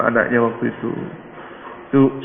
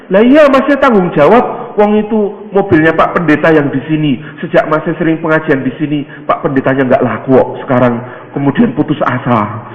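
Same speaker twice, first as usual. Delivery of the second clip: insistent urging; 3 semitones higher, 3 dB louder than usual. Acted. Villager telling him he is responsible, insistent. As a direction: press him emphatically as an excited villager.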